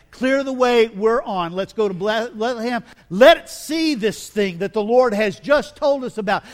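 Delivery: loud, eager rush to Bethlehem; excited shepherds. Shown as a demonstration. Preacher redoing the shepherds' line with loud excitement. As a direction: shout it out like shepherds who can't wait. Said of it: "No, I think it was a little bit more than that".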